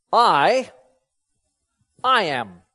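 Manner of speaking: first I loud and set apart, then a pause; emphatic I. Shown as a demonstration. Preacher showing what the Greek says, emphatic first I. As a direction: punch I alone, pause, then settle on "I am". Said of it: "This is actually an emphatic expression".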